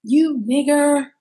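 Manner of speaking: high, drawn-out imitated scream; retold screaming. Imitating the driver screaming a slur, high and hard. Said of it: "the driver starts screaming".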